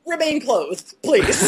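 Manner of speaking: high, laughing request; laughing plea. Retold or imitated. Vet laughing, asking client to stay clothed. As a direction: beg her to keep her clothes on through laughs. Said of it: "I'm like".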